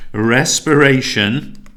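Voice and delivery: Respiration slow, loud and drawn out; emphatic. Shown as a demonstration. Teacher stresses Respiration to the class, slow and loud. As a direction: lean hard on Respiration, slowly. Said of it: "I want to stress this point to you".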